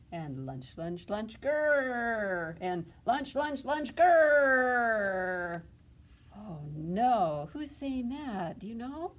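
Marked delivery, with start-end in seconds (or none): 0.5-2.6 rising wolf growl, louder; louder growling wolves. Acted. Hungry wolves growling at the sheep, louder. 3.0-5.6 high, loud wolf growl; loud growling wolves. Acted. Three hungry wolves growling at the flock, loud and high.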